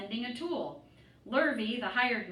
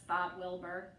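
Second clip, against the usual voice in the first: ordinary delivery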